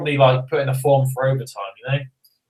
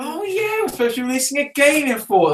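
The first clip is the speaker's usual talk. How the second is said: much higher, quick mock gasp of remembering; sudden realization; 10 semitones higher than usual. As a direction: play a staffer who just remembered, hammed-up. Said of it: "imagine them all sitting there and just suddenly thinking".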